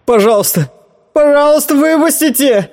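high, desperate begging on the verge of tears; sobbing plea. Acted. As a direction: plead high and tearful to be let out. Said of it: "Торин всхлипнул".

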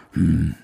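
kurzes, tiefes Brummen des Opas. Acted. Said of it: "brummt Opa". (Opa brummt nur knapp und tief)

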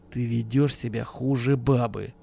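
slow, tight-jawed scorn; contemptuous, through teeth. A narrator's reading. Friend scolds his raving comrade, slow and scornful. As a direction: let it out slowly with contempt. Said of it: "процедил Акимов".

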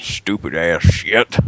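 slow grumbling grouch; grumpy old man voice. Pretend. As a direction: grumble it out slowly.

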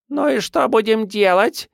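put-on very high, sly baby-monster voice; squeaky freak voice. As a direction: ask slyly in a squeaky freak voice. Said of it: "спросил уродец". (писклявый голос уродца)

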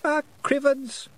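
put-on very high, slow child voice; grumbling small child. A narrator's reading. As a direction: grumble an oath as a toddler would. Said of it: "muttered Wentworth".

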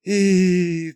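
long high-pitched drawl; drawn out. A narrator's reading. Mother marvelling at the money, drawn-out amazement. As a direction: stretch the single vowel high and long. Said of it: "протянула мама".

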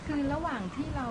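soft and distant, far from the mic; too faint. Real, not acted. Laywoman asking the monk a question, faint and far from the mic. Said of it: "เอาไมค์ใกล้ๆหน่อย มันจะไม่ได้ยิน".

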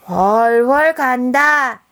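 loud, very slow drawn call. Acted. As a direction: stretch it out loudly. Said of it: "또 큰 소리가 들렸어요".

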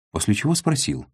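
ordinary delivery, nothing marked

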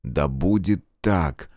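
low, slow, solemn pronouncement. A narrator's reading. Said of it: "торжественно проговорил он". (торжественно, низко и медленно)

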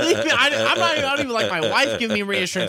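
hammed-up freak-out, shrieking high; mock panicked yell. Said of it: "I'm like".

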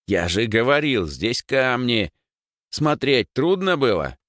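high, slow-stressed exasperated rebuke; angry scolding. Acted. Rower scolds friends after hitting rocks, angry. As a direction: scold them, dragging out the key words. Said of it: "выругался Егор".

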